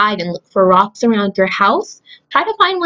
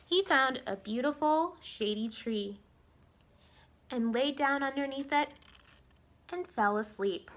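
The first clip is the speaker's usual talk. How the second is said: lively storybook reading voice; story narration; 16 dB quieter than usual. A narrator's reading. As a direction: read it like a bedtime story for kids. Reads story to children.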